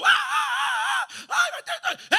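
put-on shrill wailing child, loud; crying child. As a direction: imitate a child throwing a tantrum. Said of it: "Tú sabes cuando el niño está llorando".

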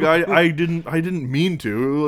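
high, loud mock panic; losing it. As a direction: imitate a flustered dad pleading. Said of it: "his dad just loses it".

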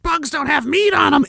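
usual speaking voice

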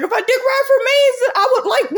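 high, loud mock outburst; retold angry retort. Retelling an argument with a friend about anime, heated. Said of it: "I got so fucking mad".

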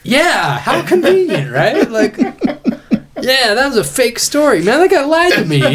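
very high-pitched, cracking up while retelling; laughing mock realization. Retelling realizing that guy lied to him, laughing realization. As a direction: squeal it through laughter, sending yourself up.

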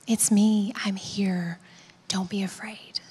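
low, hushed comfort; gentle reassurance. Acted. Jesus to those facing life's hardest, tenderly reassuring. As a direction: soothe softly, almost whispering.

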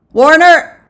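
high, loud roll call. A narrator's reading. Official calls the next man forward, loud. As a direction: call the name across a crowd. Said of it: "Then he called".

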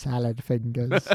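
slow, soft, creepy whisper-like drawl; Salad Fingers voice. Retold or imitated. Joking about Arya, a slow soft Salad Fingers voice. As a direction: creep it out slowly and quietly. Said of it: "we've done that bit before".

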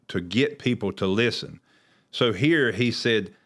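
normal talking voice

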